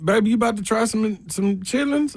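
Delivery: put-on sweet high old-lady coaxing; imitating grandma. Grandmother offering chitlins to a kid.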